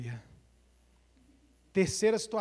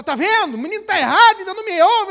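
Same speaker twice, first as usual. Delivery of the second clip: much higher, loud exasperated yell; 11 semitones higher, 14 dB louder, faster than usual. Retold or imitated. Father yelling after his son leaves the table, exasperated. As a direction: throw it after the boy storming off.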